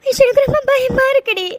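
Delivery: whimpered, sobbing fear; frightened crying. Acted. Mithu tells her confidante she is scared, frightened crying. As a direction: confess fear through tears. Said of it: "அழாதடி".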